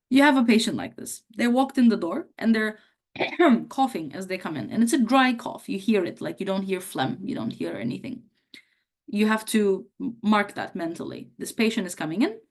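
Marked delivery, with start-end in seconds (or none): none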